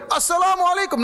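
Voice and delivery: a high, loud deliberate greeting; loud shout. Retold or imitated. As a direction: shout the salam to the statue on purpose. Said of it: "dengan suara yang keras". (salam diteriakkan keras dan tinggi)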